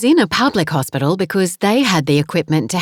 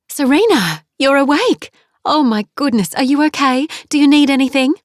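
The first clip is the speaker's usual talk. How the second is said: 2 high, excited rush of relief; 3 a mother gasping with joy as her child wakes; 4 overjoyed mother at her injured daughter's bedside; 1 surprised joy; 9 semitones higher, 3 dB louder than usual. Acted. Mother sees daughter wake.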